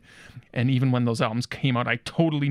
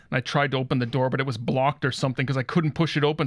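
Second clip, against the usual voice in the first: ordinary talk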